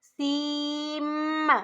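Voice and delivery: सीम held very slowly, ending on a humming म; nasal ending demo. Shown as a demonstration. Teacher correcting the ending in a slow recitation. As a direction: stretch सीम and close on a nasal म. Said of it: "सीन के एंड पर मीम की आवाज़ आनी चाहिए".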